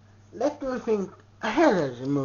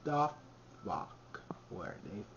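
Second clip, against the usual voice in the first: dramatic whisper; 10 semitones lower, 19 dB quieter than usual. Shown as a demonstration. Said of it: "I ask again".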